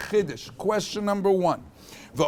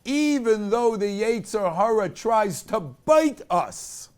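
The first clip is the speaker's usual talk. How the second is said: louder, slow and emphatic Torah preaching; preaching at full force; 4 semitones higher, 6 dB louder than usual. Acted. Teacher rousing his class at the lesson's climax, louder fervent preaching. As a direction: drive the lesson home with fervour.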